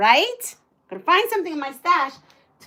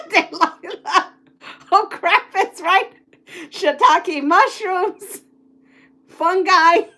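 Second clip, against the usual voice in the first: high, louder substitute curses, laughing; laughing mock swearing; 5 semitones higher, 5 dB louder, slower than usual. Real, not acted. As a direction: swap curses for silly words, laughing. Substitute swear words while laughing.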